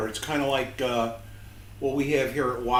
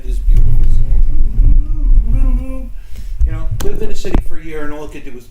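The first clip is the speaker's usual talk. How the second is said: mock bird song; 6 semitones higher, 21 dB louder than usual. Joking about pigeons, a loud high mock call. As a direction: belt it like a silly bird.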